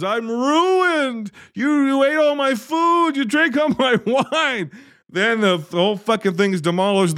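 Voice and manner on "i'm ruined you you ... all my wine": innkeeper wailing. Retold or imitated. Ruined innkeeper wailing at the drunk guest, high mock whine. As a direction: whine it like a robbed innkeeper, pitched way up. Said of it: "he goes".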